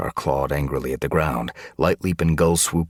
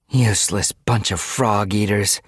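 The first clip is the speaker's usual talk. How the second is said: scornful, higher-pitched sneer; contemptuous snort; 5 semitones higher, 3 dB louder than usual. A narrator's reading. Guard insulting the trapped cats, contemptuous snort. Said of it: "Brackenpelt had snorted".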